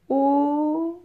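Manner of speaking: O said high and loud, open; teacher shows the open O; open o sound. Shown as a demonstration. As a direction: stress the open O of école. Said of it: "mais qui est un peu ouvert".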